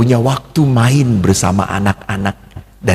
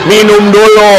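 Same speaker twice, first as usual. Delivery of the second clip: higher, louder, hammed-up call; mimicked loud urging; 6 semitones higher, 6 dB louder than usual. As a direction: imitate someone loudly urging a drink. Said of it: "cuma ngomong". (menirukan seruan dengan suara lantang)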